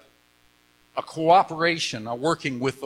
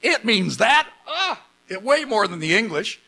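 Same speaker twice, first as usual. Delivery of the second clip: high, louder astonished outburst; excited amazement; 6 semitones higher, 3 dB louder than usual. Retold or imitated. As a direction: act out an amazed discovery in the text. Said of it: "I get excited about that".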